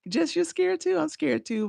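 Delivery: high, bright surprise; surprised. Real, not acted. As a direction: react as if caught off guard. Surprised reply to viewer.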